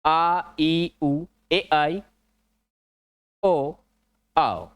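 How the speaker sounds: each vowel आ ई उ ए आई ओ आओ said slowly and separately, with pauses; pure vowel pronunciation. Shown as a demonstration. Teacher showing students the pure vowel sounds, spaced apart. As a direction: sound out आ, ई, उ, ए, आई, ओ, आओ one by one. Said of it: "ये इसका शुद्ध उच्चारण".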